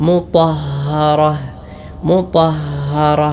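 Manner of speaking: മുതഹ്ഹറ slow with a clear doubled ha; correct pronunciation. Shown as a demonstration. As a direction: lean into the ha of മുതഹ്ഹറ. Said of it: "ശരിയായ രൂപത്തിൽ ഉച്ചരിക്കണമെങ്കിൽ". (മുതഹ്ഹറ ഹായിൽ ശ്രദ്ധിച്ച്, സാവധാനം)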